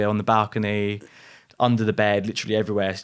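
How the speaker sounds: ordinary talk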